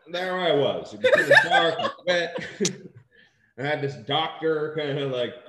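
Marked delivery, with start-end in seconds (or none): 0.1-3.6 laughing. Real, not acted.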